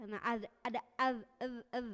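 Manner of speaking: "az" held with a buzzing tail; az demo. Shown as a demonstration. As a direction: sustain the z in az. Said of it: "Itu mah jadi mengalir lembut".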